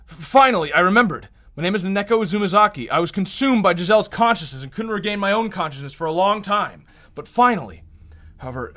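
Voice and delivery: overjoyed relief. Acted. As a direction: burst out as memory returns. Heroine regains her own identity.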